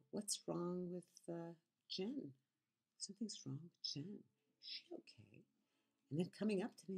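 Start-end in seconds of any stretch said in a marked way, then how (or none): 0.1-5.4 very soft gossiping undertone; hushed fake concern. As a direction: imitate a coworker confiding mock worry. Retells coworker's feigned concern to others.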